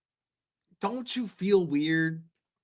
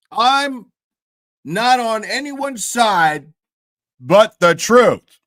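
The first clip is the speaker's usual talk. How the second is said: much higher and louder, hammed-up righteous proclamation; mock Vinny; 7 semitones higher, 7 dB louder than usual. Streamer mocks the host's pious neutrality, loud and high. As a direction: ham up a pompous declaration, slowing at the end. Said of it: "Vinny wants to be like".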